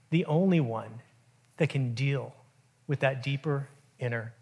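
soft, low and weighty, with long pauses; hushed solemnity. Acted. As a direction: speak quietly as Jesus revealing who he is. Preacher gently voices Jesus to Pharisees.

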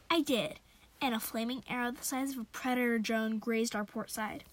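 ordinary delivery, nothing marked

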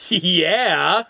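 high, loud, drawn-out approving yeah; imitated impressed teenager. Teenager to his friend after the trailer, impressed yeah. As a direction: savor it as a sold teen moviegoer. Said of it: "looks at his friend and just goes".

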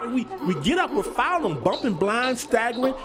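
high, urgent storytelling; frantic narration. A narrator's reading. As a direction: keep the panic up as you narrate. Narrating the panicked night scramble.

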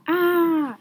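short high loud scream. A narrator's reading. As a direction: cry out in terror at the crocodile. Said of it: "screamed Ralph".

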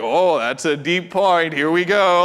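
slow, half-joking appreciation; slow mock-impressed; getting into it. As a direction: savor it, drawn out. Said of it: "And I'm like".

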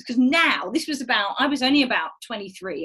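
usual speaking voice

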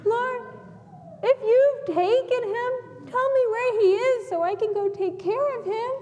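high-pitched, mildly distressed pleading; acted bewildered plea; sad and confused. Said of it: "She's sad and confused".

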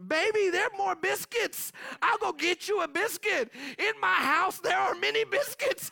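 calm them down but lose patience; exasperated parent. Retold or imitated. Parent calming a child about biscuits, loud.

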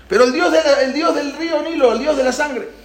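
high, incredulous pleading; dismayed protest. Acted. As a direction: protest as if it cannot be true. Egipcios protestan ante los policías. (protesta incrédula, voz aguda)

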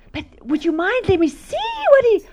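high, pleading insistence; imitating insistent woman. Visitor begging to see the note, high insistent plea. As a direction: push politely, pitch climbing high. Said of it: "And she was insisting".

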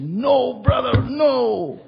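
high, slow pleading no; high slow alarmed plea; alarmed pastor. Retold or imitated. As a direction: beg him to stop, drawn out. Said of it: "And I said".

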